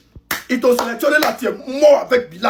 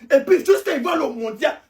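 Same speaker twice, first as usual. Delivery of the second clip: ordinary talk, nothing marked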